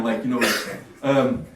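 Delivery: ordinary talk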